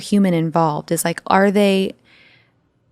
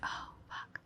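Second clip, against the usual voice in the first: whispered curse; 24 dB quieter than usual. Retold or imitated. Retold inner reaction to being caught.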